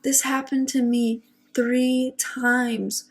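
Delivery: usual speaking voice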